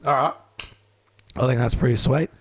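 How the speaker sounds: strained, losing his voice; rough and worn-out voice; hoarse voice. Real, not acted. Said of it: "Ben's got no voice".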